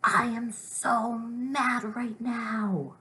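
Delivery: loud, high, very slow angry exclamation; demonstrative big-feeling outburst; pretend mad. Shown as a demonstration. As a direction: exclaim it with exaggerated anger, stretching each word. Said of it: "really angry about, but pretend".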